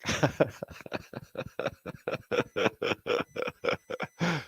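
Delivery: long breathy wheezing laugh. Real, not acted. Streamer cracking up at his own remark, laughing. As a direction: laugh till it thins out into a wheeze.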